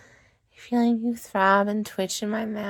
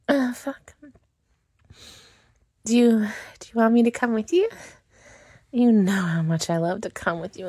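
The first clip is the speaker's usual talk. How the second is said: seductive roleplay; 4 semitones higher than usual. Acted. Pleading with her lover, seductive and intimate. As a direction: tease and beg him, close to the mic.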